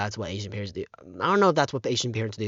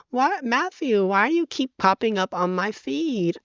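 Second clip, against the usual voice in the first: much higher, teasing mock complaint; playful cousin voice; 12 semitones higher, 3 dB louder than usual. Cousin joking that Matthew keeps popping up, high teasing voice. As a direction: tease him like a fond older cousin. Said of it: "she's like".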